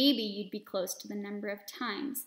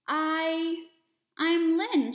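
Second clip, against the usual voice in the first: stuttering; 6 semitones higher, 5 dB louder, much slower than usual. A narrator's reading. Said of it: "she stuttered".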